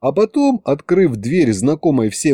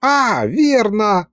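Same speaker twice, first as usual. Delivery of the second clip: eager agreement; 8 semitones higher, much slower than usual. A narrator's reading. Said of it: "закивал колдун".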